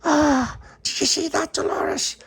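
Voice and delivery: very high squeal of amazement; gasp, then squeak the question; high, astonished child; amazed gasp. Acted. Child amazed bird has vanished.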